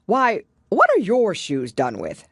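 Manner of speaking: loud, eager Gryphon voice. A narrator's reading.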